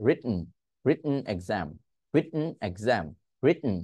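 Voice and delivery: "রিটন" as rit, catch, n; "রিটন" broken by a stopped breath; glottal stop T. Shown as a demonstration. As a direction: swallow the T in "রিটন", hum the n. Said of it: "আপনি বলার চেষ্টা করেন".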